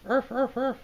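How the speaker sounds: high playful mock barks; dog barking. Dog on the card howling at the moon, high mock barks. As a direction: yap like a little dog. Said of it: "You know, dog".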